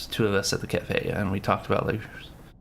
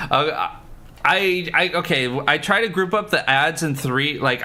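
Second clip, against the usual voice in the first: ordinary talk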